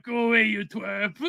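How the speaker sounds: mock-grumpy brush-off, half-laughing; imitating Kissinger. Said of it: "I always remember that".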